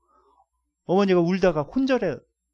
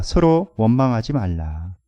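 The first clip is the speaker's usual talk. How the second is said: 서로 원망하지 말라 read louder and slow, rising on 서로; scripture reading aloud; 6 dB louder, slower than usual. Shown as a demonstration. Preacher reading the verse aloud with the church, slow and loud. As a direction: declaim 서로 원망하지 말라 slowly and loudly with the congregation. 다 함께 한번 읽어볼까요.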